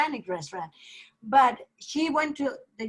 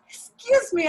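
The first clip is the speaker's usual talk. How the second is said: high, louder imitation of a customer calling; calling the server; 11 semitones higher, 5 dB louder, slower than usual. Imitating Carolina calling the drinks server, high-pitched. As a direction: call for attention, pitched right up.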